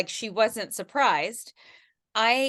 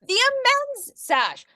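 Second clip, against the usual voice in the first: excited; 12 semitones higher, 3 dB louder than usual. Real, not acted. Echoing the product name excitedly.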